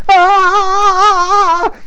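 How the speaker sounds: high loud scream of fright; kid scream. Acted. Little Dewey screaming as they run. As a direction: shriek like a terrified boy.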